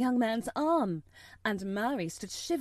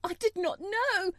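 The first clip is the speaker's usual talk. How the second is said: much higher, distraught protest; frantic cry; 11 semitones higher, 3 dB louder than usual. Acted. Mother crying to the officer, high and frantic. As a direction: cry it out in panic. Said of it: "the Mem Sahib cried".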